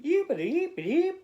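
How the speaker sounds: very high, loud chirping yeeps; high bird chirp. A narrator's reading. Child turned bird, chirping at the bird: high loud chirp. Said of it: "and you said".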